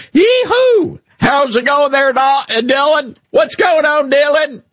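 high-pitched Southern whoop and holler; over-the-top Southern. Acted. Host greets Dylan in a fake accent, over-the-top Southern. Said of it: "I have a Southern accent, but you're like".

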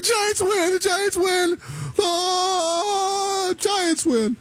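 high, stretched-out celebratory call, hammed up; mock home-run call. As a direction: overdo a radio victory call, drawn out. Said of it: "It would be my kind of nasally deep voice".

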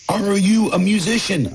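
shouting a question across a noisy bar; yelled over noise. A narrator's reading. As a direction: call it out high over the band. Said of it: "I yelled over a cacophony".

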